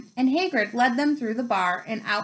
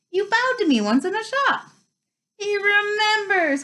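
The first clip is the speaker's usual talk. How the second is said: high-pitched, overjoyed exclamation; thrilled cry; 10 semitones higher, 4 dB louder than usual. A narrator's reading. Excited fan remembered by his hero, thrilled high cry. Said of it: "cried Douglas Diggle".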